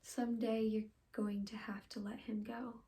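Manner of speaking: soft, halting stage farewell; dramatic monologue. Acted. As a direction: take leave quietly, with long silences. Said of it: "I'll be doing a monologue".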